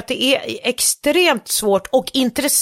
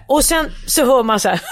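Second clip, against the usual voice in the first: usual speaking voice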